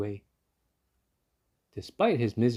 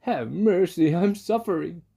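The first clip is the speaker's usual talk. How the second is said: high, louder pleading cry; weeping plea; 8 semitones higher, 6 dB louder than usual. Acted. Fox begging for mercy at the door, loud weepy plea. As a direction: plead through tears. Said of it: "he wept so bitterly".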